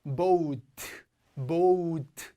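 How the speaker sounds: boat as long BO-UT; o gliding to u. Shown as a demonstration. Teacher showing students the vowel glide, boat as BO-UT. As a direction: stretch boat from o into u. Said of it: "오에서 우 소리로 넘어가요".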